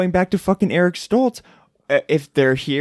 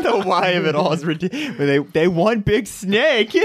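talking through real laughter; laughing; 4 semitones higher than usual. Real, not acted. Co-host tickled by why it's all ridiculous, laughing. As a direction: let the laugh keep breaking into the words. Cracking up over plot theory.

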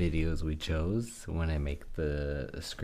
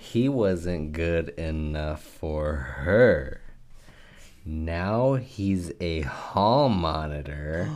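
slow dramatic lyric reading; 4 dB louder, much slower than usual. Acted.

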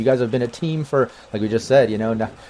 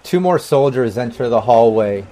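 ordinary talk

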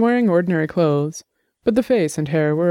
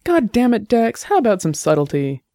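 loud exasperated; 6 semitones higher than usual. A narrator's reading. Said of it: "Annabelle's voice sounded loudly in his ears".

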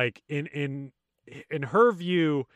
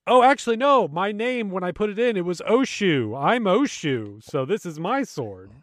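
higher-pitched pretend sword owner; playful bluff in a raised voice; mock innocent claim; 5 semitones higher than usual. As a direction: pretend it was yours all along, pitched up. Jokingly claims to be Oshu.

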